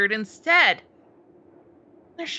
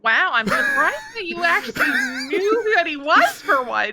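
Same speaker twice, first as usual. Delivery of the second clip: laughing; 4 semitones higher than usual. Real, not acted. Teasing friend, laughing.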